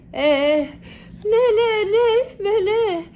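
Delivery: high, slow, quavering yeses; a terrified mouse clinging to a bat's neck; scared. A narrator's reading. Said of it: "κρατιέται πολύ σφιχτά".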